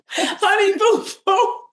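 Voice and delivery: very high, slow, crying-sounding mock wail; silly wailing voice. Repeating the name for laughs, high slow mock wail. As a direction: stretch the name into a high, whiny, fake sob. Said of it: "Now we're just getting overly silly".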